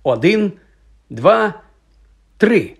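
high, deliberate count with pauses. A narrator's reading. Boy counts friends in to drink the potion, calling out.

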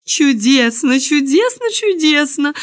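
high, delighted repeated exclamations; rapturous chorus. A narrator's reading. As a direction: gush it like admiring courtiers on all sides. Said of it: "только и слышалось со всех сторон".

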